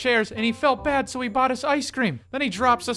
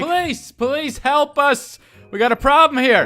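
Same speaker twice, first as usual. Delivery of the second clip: urgent call; 6 semitones higher, 7 dB louder, slower than usual. Retold or imitated. Running to police for help.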